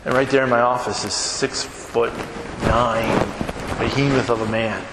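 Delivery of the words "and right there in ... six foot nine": ordinary talk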